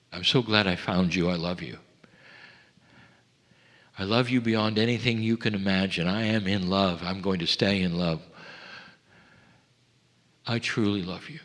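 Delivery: soft, hushed lover's words; tender declaration. Pretend. A lover softly telling you they love you. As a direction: murmur it like to a beloved.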